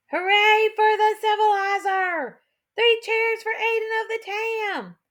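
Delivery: high, drawn-out cheer; crowd cheering. A narrator's reading. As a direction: cheer loud for the hero.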